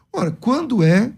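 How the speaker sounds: ordinary talk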